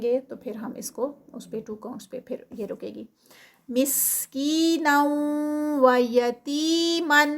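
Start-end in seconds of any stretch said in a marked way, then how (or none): 3.7-7.2 مسکینا و یتیما recited very slowly, pausing between words; very slow, held مسکینا; slow recitation. Shown as a demonstration. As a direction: take it slowly, word by word. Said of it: "بہت روک کے آہستہ آہستہ".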